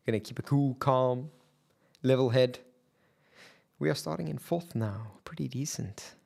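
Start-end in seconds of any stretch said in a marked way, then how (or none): none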